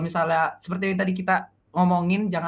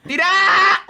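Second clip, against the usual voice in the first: loud, high cry of disbelief; shocked shout; 13 semitones higher, 9 dB louder than usual. Real, not acted. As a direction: yell in shock. Reacting to prank reveal.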